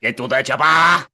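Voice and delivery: loud Arnold bark; loud Arnold impression; Schwarzenegger impression. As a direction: shout it like the action hero. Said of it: "That sounded like Arnold Schwarzenegger".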